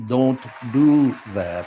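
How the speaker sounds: put-on slow, stern kid's warning; mock stern grandson scolding; stern little boy. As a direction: drag it out like a stern toddler. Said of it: "repeating the grandson's stern line".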